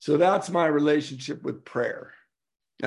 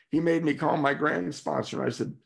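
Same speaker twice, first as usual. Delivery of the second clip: ordinary talk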